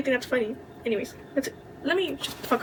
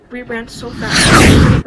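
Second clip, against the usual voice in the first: every word dragged out long and much louder; emphatic stretch; 15 dB louder, much slower than usual. Retold or imitated. Telling friends how fast they ran for food, drawn-out loud emphasis. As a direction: stretch it out big to show how hard you ran.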